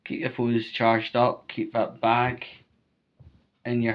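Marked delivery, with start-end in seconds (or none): none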